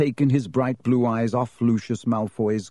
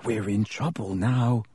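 hoarse; 4 dB quieter than usual. A narrator's reading. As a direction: say it hoarsely, under your breath. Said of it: "he said hoarsely".

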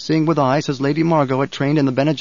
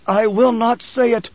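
slow, higher, strained gasp; 8 semitones higher, slower than usual. A narrator's reading. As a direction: force it out through strain. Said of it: "Paul gasped".

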